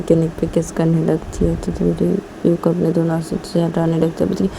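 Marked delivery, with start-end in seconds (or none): none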